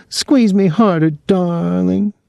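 very high hammed-up swoon; high-pitched pretend sweetheart; mock passion. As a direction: coo it like a fake lover. Boys act out lovers to provoke.

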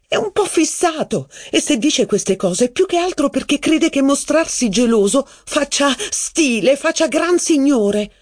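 high female voice. Acted. Mistress mocking Robert's jealousy to the narrator. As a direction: play the scornful mistress.

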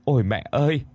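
high, pleading grief; tearful lament. A narrator's reading. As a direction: beg your dead mother's forgiveness. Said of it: "nước mắt thì lưng tròng mà rằng".

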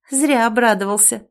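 ordinary delivery

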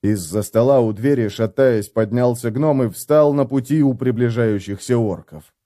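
ordinary delivery

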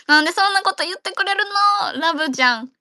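high, bright flustered squeal of delight. Real, not acted. Thrilled when her friend praises her voice, high and flustered. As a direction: gush like you can't believe the compliment. Flattered by a compliment.

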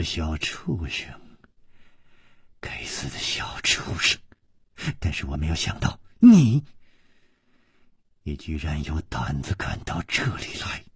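low, hushed, venomous threat; cold menacing woman. A narrator's reading. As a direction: curse under the breath, low and cold. (阴冷低声地咒骂)

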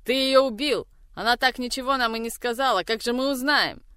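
high, loud and fast accusing yell; furious shriek. Acted. Mother rages at her son over the dead witness. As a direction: scream it in outrage.

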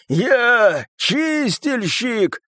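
very slow, high, strained declaration; rasped cry. A narrator's reading. Славик with cut throat, still standing, rasping. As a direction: stretch each word out defiantly. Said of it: "прохрипел он".

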